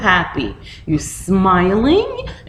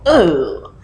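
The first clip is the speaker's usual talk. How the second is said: disgusted; slower than usual. Retold or imitated. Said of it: "I go".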